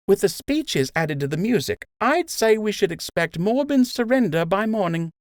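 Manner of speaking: mock the big meeting lightly; wry nurse Emma. Emma joking with Joe.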